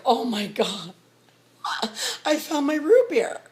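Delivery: high, loud delighted cry; excited. Retold or imitated. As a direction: burst out with the joy of finding it. Finding the ingredients at store.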